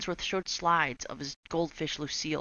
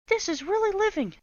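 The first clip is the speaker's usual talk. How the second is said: put-on high, loud happy children; delighted kids; 4 dB louder than usual. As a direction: gush like kids having fun. Kids enjoying themselves.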